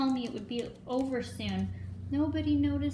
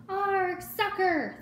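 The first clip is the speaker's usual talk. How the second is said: much higher, loud group yell; crew yelling; 9 semitones higher, 4 dB louder, much slower than usual. A narrator's reading. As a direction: cheer it like a whole crew. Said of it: "the crew yelled".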